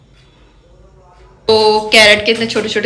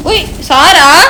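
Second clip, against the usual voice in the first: drawn-out call; 6 semitones higher, 4 dB louder, much slower than usual. Real, not acted. Calling the parrot.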